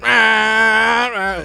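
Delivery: high, loud rah rah war cry; hammed-up battle roar; high mock battle cry. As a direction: bark it out like a cartoon warrior.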